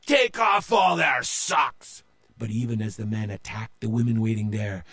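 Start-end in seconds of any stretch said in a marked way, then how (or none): 0.1-2.0 higher, loud slow shout; battle shout. A narrator's reading. Captain shouting orders mid-battle. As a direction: bellow the order. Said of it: "Joe cried out".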